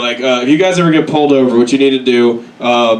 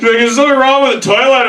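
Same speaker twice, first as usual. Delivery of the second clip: higher, fast deaf mom voice; imitating mom asking, higher and faster; 8 semitones higher, faster than usual. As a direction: ask it quick and high. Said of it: "she's like".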